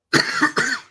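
real dry cough; short harsh cough, no words; coughing fit. Real, not acted. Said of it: "apologies for my constant coughing".

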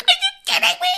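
extremely high mock squeak; squeaky. Imitating Rosie coming out with wrecked vocal cords, absurdly squeaky. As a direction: squeal it in a tiny strained voice. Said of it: "she's suddenly really squeaky".

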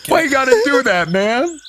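indignant clown runner. Acted. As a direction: play the wronged clown complaining at the party. GM voices the clown.

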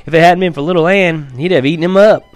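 high, loud proud defence; excited boy. Acted. Boy heatedly defends his pup.

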